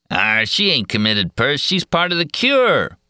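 sneering loafer. A narrator's reading. Second loafer answers Perce.

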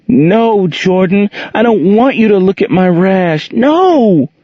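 put-on girl begging her stepfather to leave; scared little girl. A narrator's reading. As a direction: push him away, voice rising in fear. Angel voiced high, scared.

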